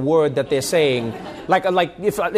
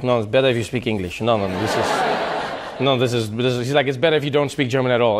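lower, lightly Swiss-German voiced dad; a hint of a Swiss-German accent; dad's Swiss-German accent; 5 semitones lower than usual. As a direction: gently stop the son's German.

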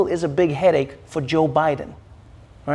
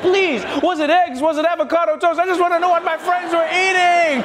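desperate begging; 10 semitones higher than usual. Retold or imitated. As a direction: beg like you're starving for news. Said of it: "You'll be like".

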